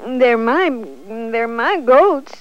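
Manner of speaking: put-on loud upset child; whiny complaint. A narrator's reading. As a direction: complain loudly about the goats. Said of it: "said the boy".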